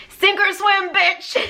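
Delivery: sassy high tough-love taunt. Pretend. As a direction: fling it out like a blunt drill sergeant with attitude. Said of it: "other guides that are like".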